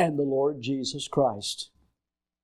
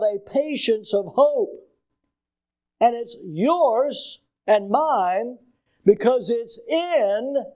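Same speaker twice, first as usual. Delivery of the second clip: slow, higher-pitched pulpit delivery with stretched key words; emphatic preaching; 6 semitones higher, much slower than usual. Real, not acted. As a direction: hammer each phrase home to the congregation. Preaching with force.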